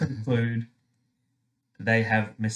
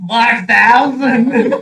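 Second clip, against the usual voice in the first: much higher, loud, slow disbelief; astonished; 12 semitones higher, 14 dB louder, much slower than usual. Real, not acted. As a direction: gasp out the number in disbelief. Shock at offspring number.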